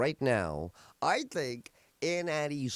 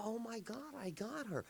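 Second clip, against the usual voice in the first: hushed surprise; 8 semitones higher, 12 dB quieter than usual. Retold or imitated. Surprised he reached aunt.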